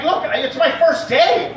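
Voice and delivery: high, slow mock-panicked protest. New doctor protesting in the ER, mock-panicked high voice. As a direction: plead inexperience, pitched well up.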